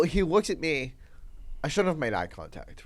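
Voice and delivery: ordinary talk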